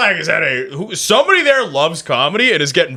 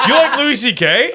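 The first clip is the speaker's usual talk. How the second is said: retold needling question; 11 semitones higher than usual. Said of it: "He goes".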